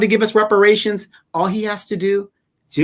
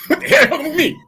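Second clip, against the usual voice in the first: crank the outrage up high; Jim Clyburn impression; 8 semitones higher, much faster than usual. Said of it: "my favorite [name] impersonation".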